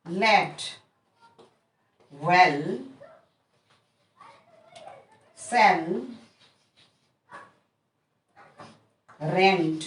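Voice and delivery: रेड, बेड, सेंड said with a short clear e, each word alone after a pause; short e demo words. Shown as a demonstration. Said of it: "इस तरह से बोलेंगे".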